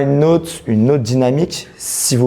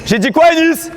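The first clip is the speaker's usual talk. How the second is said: shouting; 12 semitones higher than usual. Real, not acted. Coach shouting at player.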